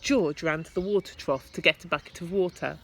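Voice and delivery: ordinary delivery, nothing marked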